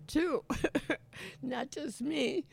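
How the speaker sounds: playful falsetto. Pretend. Host jokes with listeners.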